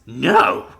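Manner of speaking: loud, flat refusal shouted out; yell. Acted. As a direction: bark a hard no. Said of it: "he yelled".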